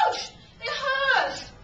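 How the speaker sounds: high cry of pain; acted pain. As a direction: yelp as the rope bites, acted out. Acting pain from tight rope.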